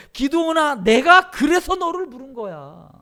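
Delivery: loud, high, emphatic calling voice; God's reply. Acted. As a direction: answer forcefully, calling out to him. Voicing God's emphatic reply to Gideon. (크고 높게 힘주어 대답하는 목소리)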